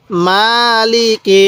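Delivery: মালিকি read very slowly, with মা held long and লি and কি kept short; slow drawn-out recitation. Shown as a demonstration. Teacher reciting মালিকি for students, slowly drawn out. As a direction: stretch only the মা in মালিকি. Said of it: "কীভাবে পড়ব".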